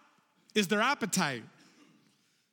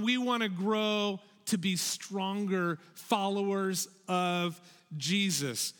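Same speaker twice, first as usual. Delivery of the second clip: normal talking voice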